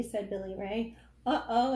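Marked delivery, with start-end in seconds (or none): 1.3-1.7 louder, worried little cry. Acted. Shark fearing the dentist, a worried louder cry. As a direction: sound alarmed for the shark. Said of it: "Clark was worried".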